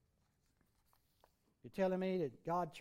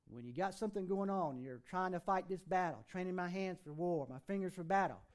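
usual speaking voice

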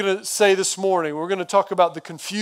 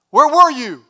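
loud, higher-pitched accusing question; acted reproach; 5 semitones higher, 8 dB louder than usual. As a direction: demand an answer as a hurt Martha would. Martha reproaching Jesus, acted.

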